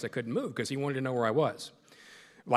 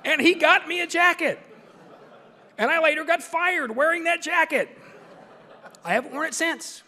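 laughing punchline; 7 dB louder than usual. Real, not acted.

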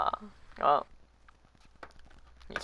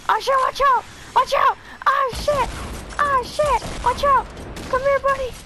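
panicked shouting at teammates; yelling; 10 dB louder than usual. Real, not acted. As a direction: yell out in alarm.

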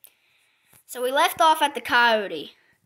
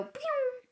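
high, soft pew whistle; mock pew sound; 13 semitones higher, 6 dB quieter than usual. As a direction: imitate milk shooting out. Imitated sound effect.

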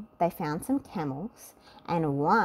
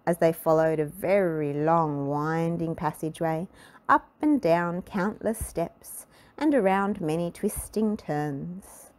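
ordinary delivery, nothing marked

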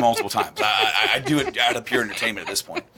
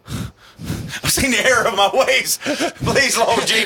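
high, slow, laughing plea to the Lord; mock repentant addict; 10 semitones higher, 4 dB louder, slower than usual. Mocking him as an addict begging Jesus, half-sung. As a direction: ham up a pleading sinner with laughter. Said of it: "like a drug addict going".

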